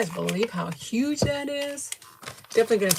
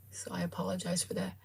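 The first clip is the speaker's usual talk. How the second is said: whispering; 12 dB quieter than usual. Real, not acted. Quiet aside apology to viewers.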